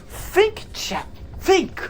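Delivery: high squeaky dramatic plea. Acted. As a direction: squeak it out at chat. Rhetorical challenge to chat.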